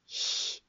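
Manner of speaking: soft, unvoiced "shh"; whispered shush. A narrator's reading. Professor hushing the howling giant, whispered shush. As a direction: hush someone under your breath. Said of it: "diye fısıldadı".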